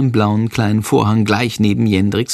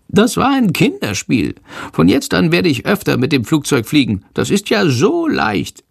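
high, cocky bat voice; boastful little bat; 6 semitones higher than usual. A narrator's reading. Bat boasts to the boy about slipping past controls, high-pitched. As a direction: brag in a high little creature voice.

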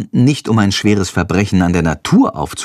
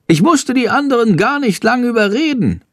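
higher chattering bird voice; plappernd; 11 semitones higher than usual. A narrator's reading. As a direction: babble it out eagerly. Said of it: "plappert Schandor". (Schandor plappert Jendrik zu: hohe, plappernde Vogelstimme)

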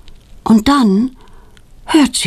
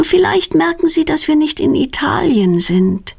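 put-on higher-pitched child character voice; Petronella, little girl; 8 semitones higher than usual. Petronella thinking over her parents' holiday at home, high child voice. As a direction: voice a girl wondering aloud.

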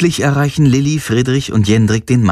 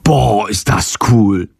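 staunend; 2 semitones lower, slower than usual. Acted. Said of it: "Friedrich staunt".

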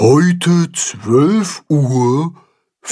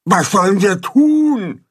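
higher, different animal voice; worried animal voice; 9 semitones higher than usual. A narrator's reading. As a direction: call out anxiously. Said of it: "Alle rufen durcheinander".

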